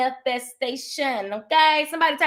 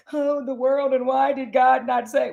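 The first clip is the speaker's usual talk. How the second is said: mock lament. Mocking a self-pitying mourner.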